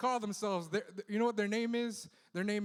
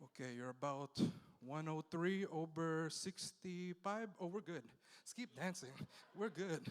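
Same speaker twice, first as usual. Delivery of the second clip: murmured nurse aside; 2 semitones lower, 8 dB quieter than usual. Pretend. Comic as a clubbing nurse, a soft mock reading of vitals. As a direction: hush it down like a nurse checking a patient.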